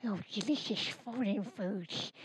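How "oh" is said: ordinary delivery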